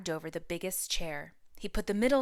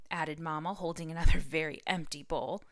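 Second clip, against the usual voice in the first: ordinary delivery, nothing marked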